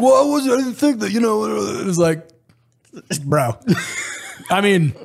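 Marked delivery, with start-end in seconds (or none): 0.0-1.4 high, loud mock defensive protest. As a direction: sputter an excuse. Doug excusing himself in AAR.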